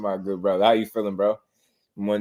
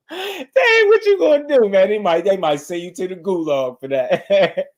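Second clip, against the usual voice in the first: very high laughing voice, settling gradually; squealing with laughter, then recovering; helpless laughter; 8 semitones higher, 4 dB louder than usual. Real, not acted. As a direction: talk through a fit of laughter. Still laughing at the comment.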